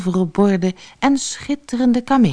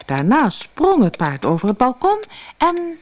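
plain reading voice

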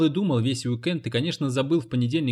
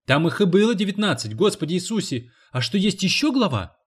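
a higher, louder, horrified charwoman; alarmed cleaning lady; 6 semitones higher, 3 dB louder than usual. A narrator's reading. Cleaning lady horrified there might be more, alarmed. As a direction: recoil at the thought of more chapters. Jilsy voiced by narrator.

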